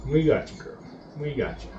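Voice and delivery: quiet, low comforting murmur; gentle reassurance. Real, not acted. Lowering the hen into her bath, soft and comforting. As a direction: ease her into the water gently.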